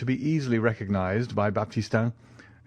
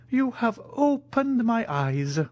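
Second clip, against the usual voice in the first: slow, high-pitched solemn major; grave; 13 semitones higher, slower than usual. A narrator's reading. Italian thanks the Count for the warning, gravely. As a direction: say it slowly with solemn weight. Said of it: "said the Italian gravely".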